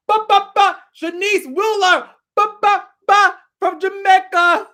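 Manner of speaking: high, loud, hammed-up Jamaican accent; bad Jamaican accent. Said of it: "That was a horrible accent".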